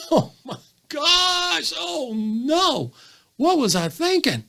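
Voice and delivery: high, drawn-out laughing exclamation; laughing squirrel. Acted. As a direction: act out a squirrel laughing at its own near miss. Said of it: "the squirrel may be laughing really loud".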